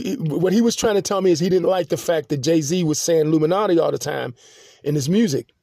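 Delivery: ordinary delivery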